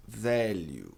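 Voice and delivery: value slowly with a light I; slow model word. Shown as a demonstration. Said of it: "tem um leve I". (value devagar, com um I leve)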